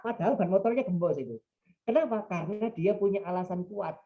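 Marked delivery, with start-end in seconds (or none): none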